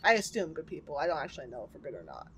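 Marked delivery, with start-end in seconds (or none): none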